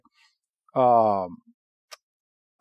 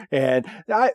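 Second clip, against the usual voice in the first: ordinary talk, nothing marked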